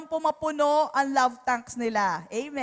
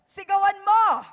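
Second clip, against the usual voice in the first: mock shout order; 6 semitones higher, 8 dB louder than usual. Mocking advice to shout.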